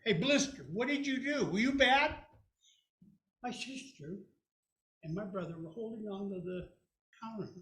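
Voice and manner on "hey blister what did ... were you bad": louder, higher mock stern dad; dad questioning. Dad asking the sister what she did. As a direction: press her for an answer.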